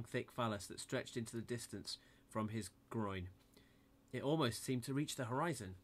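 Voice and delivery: ordinary delivery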